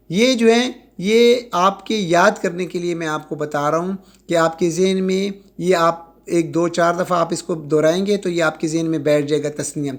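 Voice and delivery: normal talking voice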